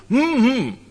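closed-mouth hum, lips sealed; strained hum with a breathy laugh; muffled hum. Retold or imitated. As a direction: hum it as if your mouth were sewn shut. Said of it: "mit hm, hm wird man nicht errettet".

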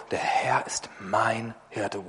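slow, hushed, breathy solemn psalm reading; dramatic recitation. Retold or imitated. Preacher imitating the polished Psalm reciter, slow hushed recital. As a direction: recite it like a showpiece, slow and whispered. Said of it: "richtig toll vorgetragen und die ganze Betonung".

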